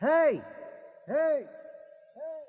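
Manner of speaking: each Hey high and fading away like an echo; Craig's shout echoing back through the tunnel. A narrator's reading. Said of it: "His voice echoed around him".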